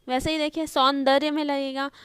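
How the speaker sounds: ordinary talk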